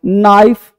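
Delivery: ordinary talk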